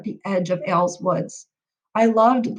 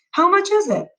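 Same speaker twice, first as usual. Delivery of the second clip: higher, pressing mom demanding the price; impatient; 10 semitones higher, 3 dB louder than usual. Acted. Mom pressing dad about the price, impatient. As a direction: push for an answer, impatient.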